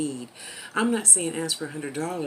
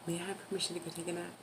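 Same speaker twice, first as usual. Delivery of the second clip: very soft, quick mock plea; quiet, hurried playful asking; hushed request; 10 dB quieter, much faster than usual. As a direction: ask permission under your breath.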